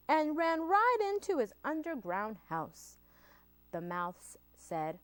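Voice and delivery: usual speaking voice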